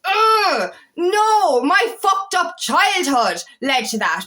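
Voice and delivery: loud, high groan into drawn-out venting; fed-up cry of protest, easing slightly; exasperated outburst. Real, not acted. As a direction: burst out in real frustration, then drag the words. Venting about childhood blame.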